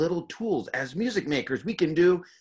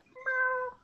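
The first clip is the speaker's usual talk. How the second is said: high little kitten meow; kitten mew; 7 dB quieter than usual. Shown as a demonstration. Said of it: "like, little kitten".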